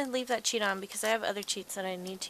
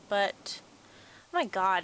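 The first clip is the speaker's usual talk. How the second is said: ordinary talk, nothing marked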